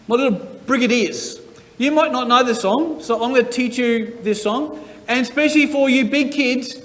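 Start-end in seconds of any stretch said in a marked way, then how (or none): none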